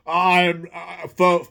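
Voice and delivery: mock-modest celebrity voice; false modesty. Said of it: "false modesty".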